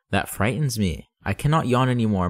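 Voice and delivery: plain reading voice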